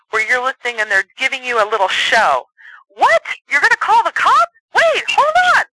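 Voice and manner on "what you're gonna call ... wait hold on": very high, loud cartoonish panic; hammed-up commercial actor. Shown as a demonstration. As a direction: overplay it like a radio ad skit. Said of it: "fun little commercials".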